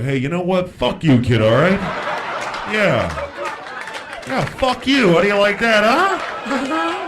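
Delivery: hammed-up parental outburst; mock angry parent. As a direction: lash out at a bratty kid. Fed-up parent cursing at kid.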